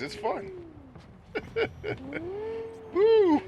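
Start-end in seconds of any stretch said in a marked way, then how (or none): 0.5-2.9 high delighted giggle. Real, not acted. Delighted laugh while driving. 2.9-3.4 loud, high whoop of joy. Real, not acted. Thrilled while slaloming.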